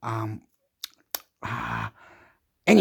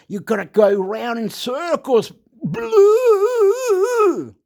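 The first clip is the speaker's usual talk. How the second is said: mock wail; 12 semitones higher, 6 dB louder, much slower than usual. Real, not acted. Frustrated wail going round in circles.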